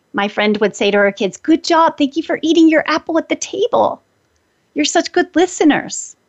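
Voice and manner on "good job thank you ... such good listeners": higher, beaming good-job voice; bright praise. Retold or imitated. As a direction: praise them like a proud mom. Friend praising her kids.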